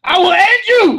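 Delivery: loud, high mock-furious yell; escalated mock threat. Comic repeating threat to partner, loud mock fury. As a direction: shout it as an over-the-top threat.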